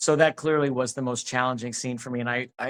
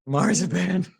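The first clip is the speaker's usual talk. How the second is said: marzipan said through a laugh, higher; laughing delight; 6 semitones higher, 3 dB louder, slower than usual. Real, not acted. Relishing the word marzipan with a laugh. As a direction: savor marzipan with a giddy laugh.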